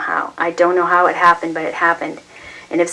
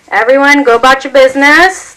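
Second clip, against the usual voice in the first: higher, louder dismissive command; loud crowd order; 8 semitones higher, 9 dB louder than usual. A narrator's reading. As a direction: wave the crowd off. Cop orders crowd away.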